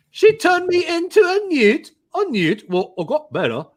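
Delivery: high screechy mock British accent, easing down; Monty Python British accent. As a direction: quote the sketch in a silly British accent. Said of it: "but also Monty Python".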